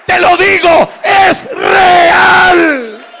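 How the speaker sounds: high, shouted preaching with drawn-out key words; prédica a pleno pulmón. Real, not acted. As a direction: proclaim it to the congregation at full force. Preaches at full force. (predicación exaltada, gritada y aguda)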